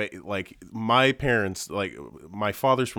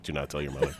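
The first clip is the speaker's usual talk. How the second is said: low warning; 8 semitones lower, 8 dB quieter than usual. Retold or imitated. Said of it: "I was like".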